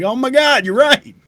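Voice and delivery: very high, mock-dismayed cry; retold shocked realisation. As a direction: squeal it in sudden comic realisation. Acting out own shocked realisation.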